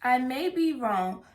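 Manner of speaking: usual speaking voice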